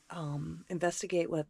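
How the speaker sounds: ordinary talk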